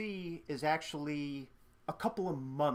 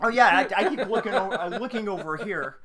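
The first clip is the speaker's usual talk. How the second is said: laughing; 4 semitones higher, 9 dB louder, faster than usual. Real, not acted. Laughing at own slip.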